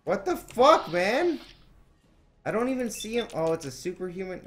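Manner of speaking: high, loud startled yelling. Real, not acted. Gamer yelling at a surprise enemy in shock. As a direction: yell out in shock at the attack. Sudden enemy attack in game.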